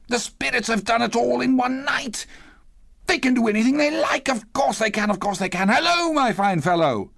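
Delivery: high-pitched, tumbling glee into a hail; elated Scrooge. A narrator's reading. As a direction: reason it out joyfully, then call to the boy. Elated Scrooge talks, then hails boy.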